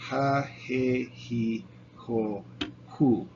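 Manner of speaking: "Ja, je, he, ho, hu" with a breathy h sound at the start; jota like h. Shown as a demonstration. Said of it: "it's like H sound".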